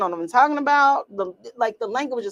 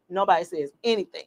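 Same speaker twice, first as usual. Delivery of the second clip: usual speaking voice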